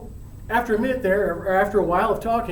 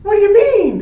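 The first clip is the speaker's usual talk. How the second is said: baffled; 12 semitones higher, 10 dB louder than usual. Acted. Juliet demands an answer.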